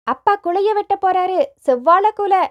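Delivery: put-on high, excited child's cheer; joyful children's cry. A narrator's reading. Children see father going to cut the bunch, gleeful shouting. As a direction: call out high with delight. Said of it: "ஆனந்த கூச்சலிட்டு கொண்டு".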